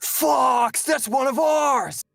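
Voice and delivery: high, loud, slow pilot call; Bill from Star Fox. Retold or imitated. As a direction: shout it over the radio mid-dogfight. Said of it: "he'll just be like".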